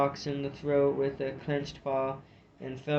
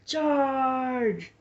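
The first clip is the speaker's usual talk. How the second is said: much higher, loud bellowed kill order; savage bellow; 11 semitones higher, 7 dB louder, much slower than usual. A narrator's reading. Gulu drives his warriors forward, bellowing charge. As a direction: bellow it at your warriors like a warlord.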